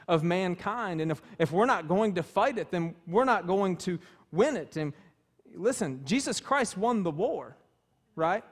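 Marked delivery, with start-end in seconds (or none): none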